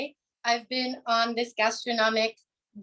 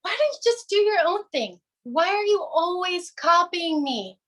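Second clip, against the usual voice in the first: put-on exasperated older sister; 6 semitones higher than usual. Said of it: "I would turn around and say".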